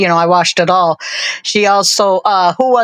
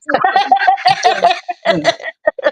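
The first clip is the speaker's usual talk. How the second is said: laughing; 11 semitones higher than usual. Real, not acted. Laughs at own joke.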